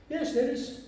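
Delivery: high-pitched timid acted answer; meek. Employee answering boss about his wife, high and meek. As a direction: answer small and high, cowed.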